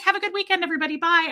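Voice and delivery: high-pitched breezy goodbye; hammed-up sweet send-off to the room; mock-cheery farewell. Embarrassed customer skipping out the door, mock-cheery. Overly cheery exit after the outburst.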